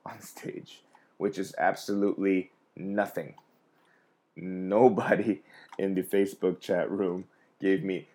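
usual speaking voice

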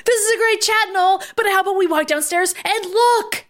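high, comically strained anime yell; over-the-top exasperated anime outburst. A narrator's reading. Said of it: "Maddie yelled".

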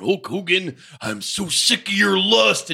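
slow, high, strained wrestler promo impression; Macho Man. Heel wrestler accusing his partner on camera, mock promo. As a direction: draw it out like a worked-up wrestling heel. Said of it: "Macho would do an interview going".

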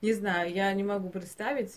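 ordinary talk, nothing marked

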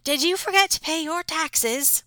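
wife rounds on her husband; accusing mother. Acted. Wife whirls on husband, accusing. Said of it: "mother whirled on him".

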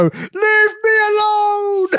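high, slow, hammed-up crying shout; hammed-up Sid wail. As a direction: drag it out like an overselling wrestler. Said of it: "Just go".

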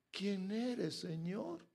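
soft, hushed asking; humbled question. Acted. Saul on the ground answering the Lord, hushed. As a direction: ask in awe, quietly.

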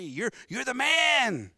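butter up the king, stretching the praise; lightly acted fawning hype; mock flattery.

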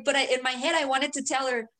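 usual speaking voice

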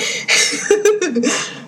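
squealing laugh; can't stop laughing at it; high-pitched laugh. Real, not acted. Cracking up reading.

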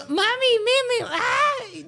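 high, slow comic whimper of exasperation; mock despairing wail. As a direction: wail like a worn-out mom. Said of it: "Y yo".

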